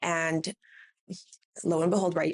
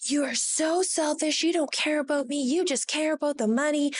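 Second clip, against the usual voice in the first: mock scream; 8 semitones higher than usual. Upset client accusing the brow artist, mock scream. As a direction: imitate an irate client's screaming without full volume. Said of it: "She's screaming at me at the top of her lungs".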